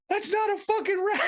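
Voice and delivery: very high-pitched mock panic; imitating shaking wife. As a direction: mimic a terrified wife, voice way up. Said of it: "shaking. And she goes".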